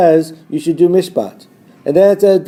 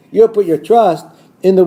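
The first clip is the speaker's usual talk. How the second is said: ordinary talk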